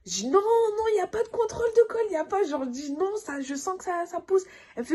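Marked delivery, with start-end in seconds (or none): 0.3-2.4 high, fast crying refusal; desperate refusal. Retold or imitated. Mother refusing the midwife's exam, high and crying. 3.0-4.4 high pressing insistence; insistent. Retold or imitated. Mother to the midwife, high and insistent.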